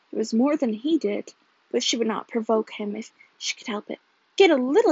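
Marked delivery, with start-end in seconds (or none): 4.4-4.9 louder, higher mocking echo. A narrator's reading. Husband echoing his wife's words, mocking. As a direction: throw her words back in mockery. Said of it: "he said mockingly".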